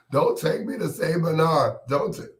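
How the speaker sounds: high-pitched mock holler; hollering and screaming. Patient pleads as they take him away, mock screaming. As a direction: holler in protest, pitched well up. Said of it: "I'll be hollering and screaming".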